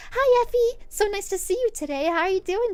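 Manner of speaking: high bubbly greeting, lightly voiced; a sweet mom gushing hello; chirpy customer voice. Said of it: "she'd be like ... a bit of a raspy voice".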